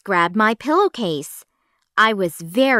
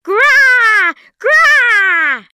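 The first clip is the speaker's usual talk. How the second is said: much higher, loud zombie shriek; furious zombie scream; 13 semitones higher, 9 dB louder than usual. A narrator's reading. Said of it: "she screamed".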